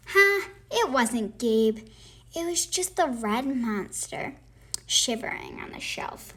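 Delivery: plain reading voice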